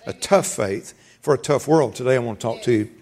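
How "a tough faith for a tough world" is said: "tough" leaned on both times, slow; slow and weighty, pressing "tough"; stress on tough. Shown as a demonstration. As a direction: hit every "tough", unhurried. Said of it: "I'm emphasizing the word tough".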